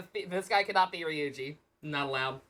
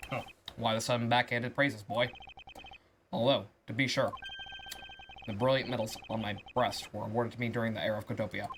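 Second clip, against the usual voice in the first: lower, settled froggy puppet voice; Kermit voice; 7 semitones lower, 4 dB quieter than usual. Acted. Ambassador mocks the lawyer's claim, lowered Kermit voice. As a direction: lean into the low Kermit impression. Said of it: "Deep Kermit voice".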